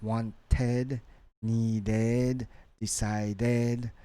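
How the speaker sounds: "wanted, needed, decided" with a sounded-out ed; ed as syllable. Shown as a demonstration. Teacher listing examples, ed as syllable in "wanted, needed, decided". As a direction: give ed its own beat in "wanted, needed, decided". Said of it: "So we have the ed".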